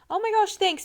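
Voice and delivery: high bright gratitude; gushing thanks. Retold or imitated. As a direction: squeal thanks happily. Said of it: "than, like".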